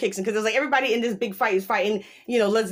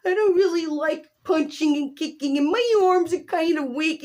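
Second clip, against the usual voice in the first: higher whiny nerd voice, lightly done; a hint of a weak nerd complaining; mock nerdy whine; Dimitri's whiny voice; 8 semitones higher than usual. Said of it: "they make him talk with that voice".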